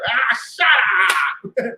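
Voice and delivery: loud mock cartoon bark; cartoon catchphrase. Quoting the cartoon line as his new catchphrase, loud. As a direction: snap it like a cartoon rooster. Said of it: "That's gonna be my new joint".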